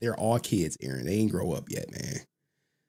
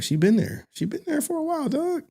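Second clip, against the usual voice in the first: high, hammed-up hype voice; pep up a friend sarcastically; high mock encouragement.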